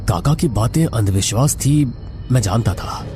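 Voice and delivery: ordinary delivery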